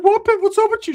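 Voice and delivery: very high, loud comic voice; cousin's odd voice. Retold or imitated. Cousin greeting the girl, high odd squawk. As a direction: squeal it way up high. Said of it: "Why does he talk like that?".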